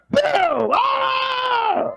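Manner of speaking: high, loud shout; sudden loud shout. Shown as a demonstration. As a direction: let it all out.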